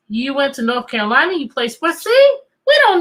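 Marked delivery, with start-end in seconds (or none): 2.0-3.0 high, loud triumphant exclamation; triumphant see. Real, not acted. Teacher proving her point to the class, high and loud. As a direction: pounce on the point with glee. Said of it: "teacher triumphant".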